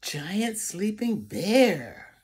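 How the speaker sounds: ordinary delivery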